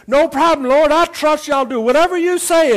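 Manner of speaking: high, loud, eager assurance; eager promise. Acted. Man eagerly promises to obey.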